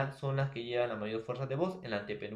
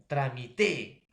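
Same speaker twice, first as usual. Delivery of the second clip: "Tramité" drawn out, stressed on final "té"; slow down "Tramité" and land on the last syllable; acento en la última; 4 dB louder, much slower than usual. Shown as a demonstration. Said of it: "dónde lo vas a colocar la tilde". («Tramité» alargado, con fuerza en «té»)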